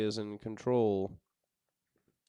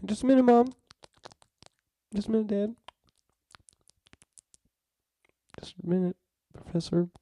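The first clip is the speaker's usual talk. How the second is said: squeaky high-pitched voice, pitched way up; 12 semitones higher, 6 dB louder than usual. Pretend. Voicing replies to mom, dad, professor.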